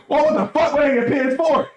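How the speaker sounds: high-pitched startled outburst; hammed-up high-voiced disbelief; mock shock. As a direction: act baffled, voice jumping way up. Acts out surprise at seeing console.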